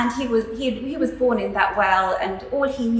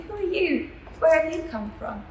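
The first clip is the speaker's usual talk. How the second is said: startled, higher-pitched questioning; shocked frog; 6 semitones higher than usual. Acted. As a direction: ask it like a taken-aback little frog. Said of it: "this frog was shocked".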